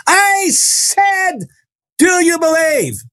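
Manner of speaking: very high, stretched-out cry; imitated fierce demand. Healer spinning round to challenge the boy, high demanding cry. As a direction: cry the question out high, drawing out each word.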